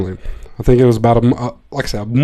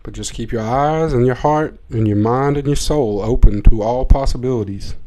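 usual speaking voice

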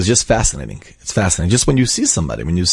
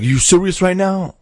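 high, drawn-out disbelief; slow, high-pitched mock astonishment; incredulous question; 11 semitones higher, slower than usual. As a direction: imitate a cop who can't believe it. Officer repeats his disbelieving question.

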